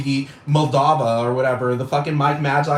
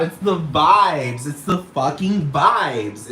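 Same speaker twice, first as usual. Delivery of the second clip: slow emphasis; much slower than usual. Real, not acted. Drawn out emphasis.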